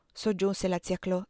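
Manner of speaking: ordinary delivery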